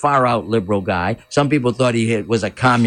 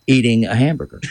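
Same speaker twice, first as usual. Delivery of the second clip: normal talking voice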